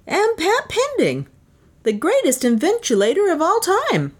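high-pitched, self-important inventor; mock-modest announcement. A narrator's reading. Inventor introduces himself to an admirer, mock-modest. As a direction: announce yourself grandly in a high voice. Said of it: "he announced modestly".

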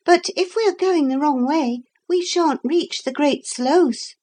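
put-on high-pitched child's objection; girl character. A narrator's reading. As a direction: raise a worry in a high girl's voice. Narrator voices the girl.

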